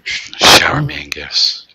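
loud, slow, grand demand; grandiose demand. Pretend. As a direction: proclaim it like royalty. Demanding gifts in mock grandeur.

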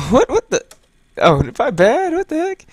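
high, shocked what the heck; startled. Real, not acted. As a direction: jump in surprise. Startled by the game.

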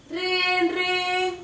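high, sustained trilled ringtone; shrill-pitched held ring noise; imitated phone ring. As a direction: sing out a phone ringing.

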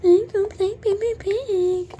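high, slow whiny mimic of a pestering kid; mock little sister. Big sister mocking her sister's Peppa Pig plea, high and whiny. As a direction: imitate an annoying little sister begging. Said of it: "She never stops asking me".

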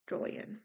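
"Julian" whispered under breath with a soft J; mouthing "Julian" to herself; a hushed test of the name; whispered retry. Real, not acted.

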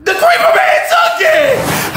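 very high, drawn-out yell; high stretched accusing shout; dramatic cry. Pretend. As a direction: wail it out slowly, way up high. Blaming the creeper man.

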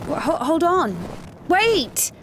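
stammer, then yell out to stop someone. Acted. Calling on someone to wait, yelling. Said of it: "Stop yelling".

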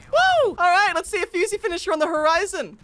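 high loud whoop then hyped call; excited cheer. Real, not acted. Gamer celebrating teammate's play, excited whoop. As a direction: celebrate loudly.

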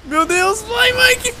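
high, loud, rising yell; frantic urging. Real, not acted. Player urges Mike to go, yelling frantically. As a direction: urge a teammate on in a panic.

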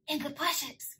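very soft, secretive whispered answer; mock whisper. Hiding brother answering his sister's question, mock whisper. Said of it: "he's whispering to me on the phone".

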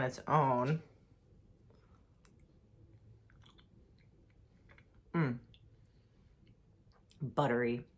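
with ice cream in mouth; tasting while talking; savoring a mouthful. Real, not acted. Seen 1: Took a spoonful at -3; tastes with closed mouth, spoon in hand.

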